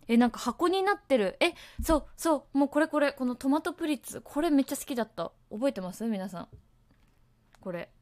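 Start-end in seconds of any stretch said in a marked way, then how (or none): 0.1-5.3 excited discovery. Real, not acted. Finds an old snack online.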